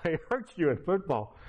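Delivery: laughing repeat. Retold or imitated. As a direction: say it again through a light laugh.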